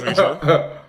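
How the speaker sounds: loud, high, laughing; laughing tease. Retold or imitated. Friend teases him through laughter, loud and high. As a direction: giggle the question out. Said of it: "And he goes".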